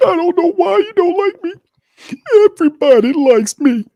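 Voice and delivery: high whiny falsetto mockery; mock whiny Carr. Mocking Derek Carr's whining, high whiny falsetto. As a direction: whine like a fake-innocent quarterback.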